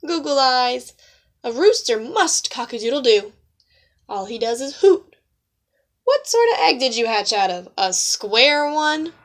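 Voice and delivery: loud, jeering hen voices; mocking hens. Hens jeering at the new owl, loud and mocking. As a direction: taunt him like spiteful hens.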